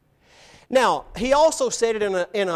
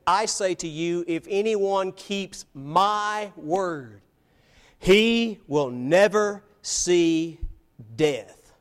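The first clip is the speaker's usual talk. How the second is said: slow, weighty pronouncement with drawn-out words; measured, each word held and set apart; solemn declaration; much slower than usual. Acted. Jesus making an audacious claim to his hearers, solemn and slow.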